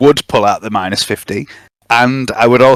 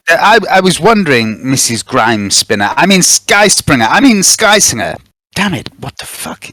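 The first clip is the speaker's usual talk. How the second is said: awkward, hammed-up fluster; flustered suitor; 3 semitones higher than usual. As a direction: trip over the name and curse yourself. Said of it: "are you quite all right".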